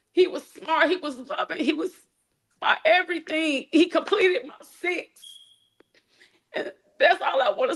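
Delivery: holding back sobs as she speaks; fighting tears. Real, not acted. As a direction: voice high and breaking with real grief. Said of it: "You got this, mama. Breathe".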